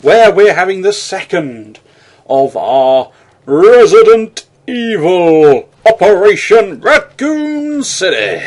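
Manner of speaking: high, slow theatrical welcome; drawn-out hammed-up host greeting; grand host voice. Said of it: "Why, hello once more, dear viewer".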